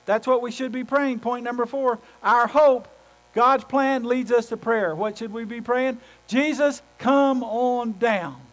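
loud, high preaching voice, slowing on the last words; full-force preaching. Acted. Pastor urging the congregation to pray, loud preaching. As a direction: drive it home from the pulpit.

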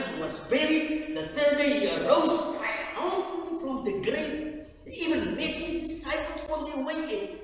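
high, loud fervent preaching; preaching at full force. Acted. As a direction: proclaim it to the whole church, loud and high.

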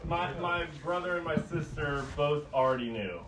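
ordinary talk

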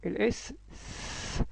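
slow voiceless hissed س; whispered sound demo. Shown as a demonstration. Teacher showing the S sound, hissed س. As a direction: hiss the س out slowly. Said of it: "نطقها ازاي".

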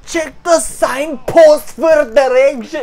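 loud, high, slow put-on British-Isles accent. Player mimicking the game character, loud put-on accent. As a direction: belt it out slowly in a mock British-Isles accent. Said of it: "I can do British".

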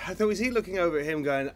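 ordinary delivery

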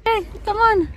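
usual speaking voice

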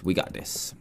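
a breathless whispered pep talk under time pressure. Real, not acted. Gamer psyching himself up mid-build, whispered. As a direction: whisper it to yourself. Racing the clock, self-encouragement.

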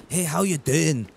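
put-on Scottish with rolled r's; rehearsed, hammy Scottish accent; Scottish accent attempt. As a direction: greet a stranger in an amateur Scottish accent. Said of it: "I tried to do a Scottish accent".

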